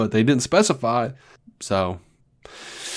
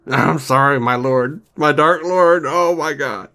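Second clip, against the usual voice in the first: groveling minion; 4 semitones higher, slower than usual. Retold or imitated.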